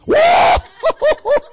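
big loud high laugh. Real, not acted. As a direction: burst out laughing loudly.

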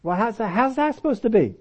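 ordinary talk, nothing marked

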